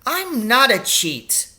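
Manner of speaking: a slow, high, loud denial; indignant yell; furious shout. Acted. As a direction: blaze back at the teacher in fury. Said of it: "I, I shouted back at him".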